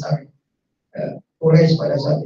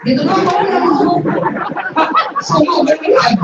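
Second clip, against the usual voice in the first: higher and louder, breaking into a laugh; laughing punchline; 13 semitones higher, 4 dB louder than usual. Real, not acted. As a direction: land the joke laughing.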